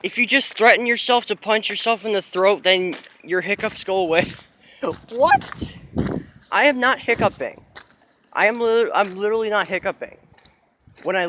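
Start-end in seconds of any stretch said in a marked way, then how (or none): 5.1-5.7 high, startled yelp of disbelief; real astonished squeak; sudden high squeal of surprise; shocked squeak. Real, not acted. Stunned hiccups suddenly stopped.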